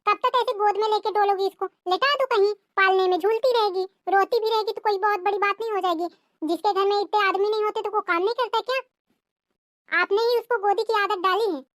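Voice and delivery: high, scornful sister-in-law taunt. Acted. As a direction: sneer at her excuses in a high voice.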